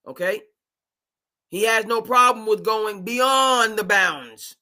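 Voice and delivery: usual speaking voice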